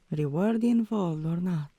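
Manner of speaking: normal talking voice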